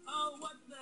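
high startled yelp; sudden alarmed cry, up high; surprised. Acted. Stunt goes wrong.

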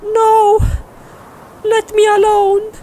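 very high, loud falsetto; frightened girlfriend. Acted. Girlfriend fending off the monster, high upset cry.

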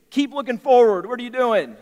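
high, loud warning shout; alarmed call. Retold or imitated. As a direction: call out to a kid riding off. Father calls to son on bike.

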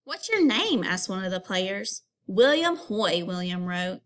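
lively read-aloud storytelling; story reading. Acted. Teacher reading a picture book aloud to students. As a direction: read the story to the class with expression.

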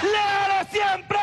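shouted rally chant, pitched high; ranting dictator speech. Real, not acted. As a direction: bellow each slogan to the crowd. (arenga gritada a todo pulmón)

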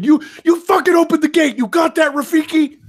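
high, loud sergeant's rant; retold yelling. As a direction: imitate an irate sergeant ordering a guard. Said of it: "he was yelling at this guy".

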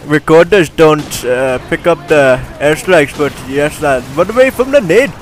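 put-on Indian-accented game commentary voice. Gamer narrating his gameplay clips, in an Indian accent. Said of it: "this is my Indian voice".

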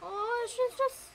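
high, squeaky cooing; adoring gush. Real, not acted. Gamer doting on her little character, adoring gush. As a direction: melt with adoration, voice pitched right up. Gushing over cute game child.

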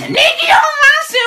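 "Nederlandse" very high and slow; mocking drawl. Shown as a demonstration.

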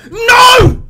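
sudden high, full-volume scream; anguished scream. Real, not acted. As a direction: scream as if you lost it all. Screams at failing in game.

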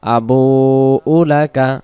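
ordinary delivery

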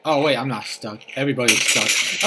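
ordinary talk

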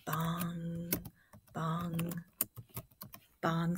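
low, quiet, spaced bongs; soft bell imitation. Shown as a demonstration. Teacher recalling the sound of the bell, soft spaced bongs. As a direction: toll it softly, leaving long gaps.

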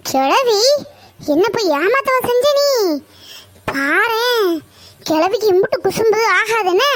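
high-pitched indignant reproach; mock-outraged scolding. Granddaughter finds grandmother has fooled her, high indignant scolding. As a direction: tell off a teasing grandmother.

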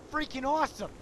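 loud gushing cry; excited. Acted. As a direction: burst out with delight. Gushing over the hammer.

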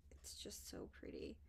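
soft dreamy whisper; admiring whisper. Real, not acted. Admiring the pink lace, soft whisper. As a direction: whisper it fondly.

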